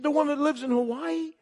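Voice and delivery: high, incredulous retold question; astonished question. Man asking Tina if it is her, astonished. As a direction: ask it in high-pitched disbelief.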